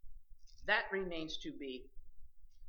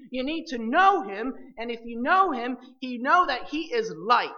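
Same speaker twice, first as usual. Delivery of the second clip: louder rising sermon drive; preaching at full force; 4 semitones higher, 9 dB louder than usual. Acted. Preacher urging believers toward fellowship, loud sermon build.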